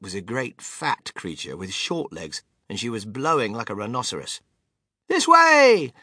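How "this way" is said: high, loud directing cry; calling out. A narrator's reading. Wonka turning left at the stairs, calling out. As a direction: sing out to those behind you. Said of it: "cried Mister Wonka".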